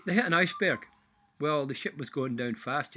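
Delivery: ordinary talk, nothing marked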